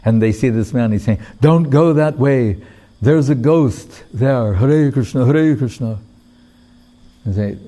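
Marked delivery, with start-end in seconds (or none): 1.4-6.0 frightened fisherman. Retold or imitated. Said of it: "frightened and went to the shore".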